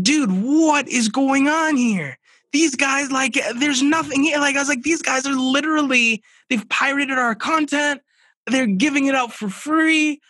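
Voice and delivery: high, loud outraged rant, replaying the moment; retelling how he freaked out over pirated books; going berserk. To his guest, reliving finding the pirates, high loud berserk outrage. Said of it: "I'm going berserk".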